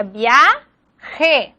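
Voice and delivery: "viaje" as via, pause, je; "viaje" broken into two separate syllables; syllable division. Shown as a demonstration. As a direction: split "viaje" in two, first part rising. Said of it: "la separación de la palabra".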